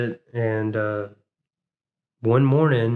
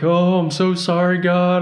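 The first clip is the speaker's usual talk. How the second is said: higher-pitched pleading prayer; retold begging; 6 semitones higher, 4 dB louder than usual. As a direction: act out begging God for forgiveness. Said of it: "just the begging".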